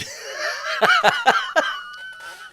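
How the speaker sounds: long squealing laugh; high laugh. Real, not acted. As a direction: howl with laughter. Big laugh.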